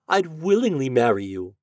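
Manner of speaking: high, mildly wistful lady's voice; lightly voiced lovestruck lady; sighing lady. A narrator's reading. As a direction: say it longingly with lifted pitch. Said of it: "she sighed".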